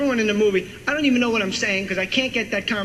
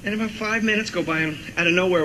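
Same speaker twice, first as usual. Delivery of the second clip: normal talking voice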